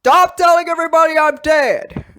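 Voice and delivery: high loud indignant shout; Natasha yelling. Acted. Natasha shouting from the back at the mourners. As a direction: yell it from the back, outraged. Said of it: "she's in the back going".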